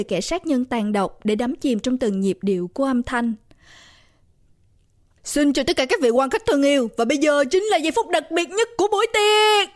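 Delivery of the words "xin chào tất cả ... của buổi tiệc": rising, projected MC welcome; party MC. A narrator's reading. MC to birthday party guests, announcing the special moment. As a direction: build up the big moment, last word held high. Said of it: "Người nam M C vừa nói".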